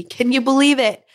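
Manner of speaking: normal talking voice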